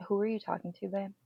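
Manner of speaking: soft, halting questions with long gaps; hushed reproach. Retold or imitated. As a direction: quietly question a child, pausing between words. Calm scolding of her daughter, retold.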